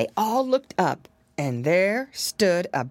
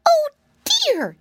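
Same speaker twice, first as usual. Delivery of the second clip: very high squeaky, louder gasp; frightened gasp; 6 dB louder than usual. A narrator's reading. Piglet hearing a voice out on the porch, frightened gasp. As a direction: gasp it out in a tiny, high scared voice. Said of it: "gasped Flop Ear".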